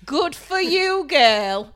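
put-on high, drawn-out sassy "Good for you, girl" with a laugh; sassy TikTok catchphrase. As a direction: laugh it out high and slow, teasing a guy. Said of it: "I will actually say to him".

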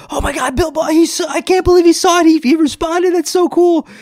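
high-pitched, rapid gushing; mock excited squeal; gushing fan. Mimics excited people approaching him.

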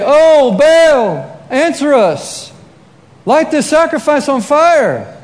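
high, loud, drawn-out call to a god; slow, theatrical wail of appeal; mock pleading invocation. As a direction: imitate prophets crying out to the heavens. Prophets calling out to Baal.

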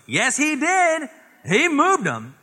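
put-on very high, loud, slow tattletale kid; sing-song tattling like a little kid; tattling sibling. Sibling telling on the brother, high and loud tattling. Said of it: "the other sibling goes".

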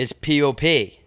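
slow, letter by letter: "P O P"; spelling out pop. Shown as a demonstration. Correcting his friend's New Hampshire pop, spelled slowly. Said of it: "It's pop".

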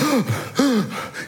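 sharp gasp, breath knocked out; high strangled gasp for breath; winded gasp. Retold or imitated. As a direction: suck in air like you just got winded. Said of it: "and I'm like".

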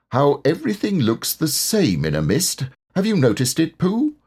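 high storybook animal voice; Rabbit. A narrator's reading.

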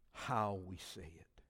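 whispered point. Real, not acted. Pastor adding a last point to the church, whispered aside. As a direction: breathe the final point.

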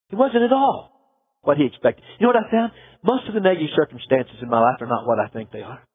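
usual speaking voice

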